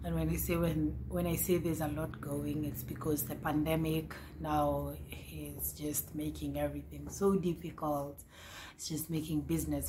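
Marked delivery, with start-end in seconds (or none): none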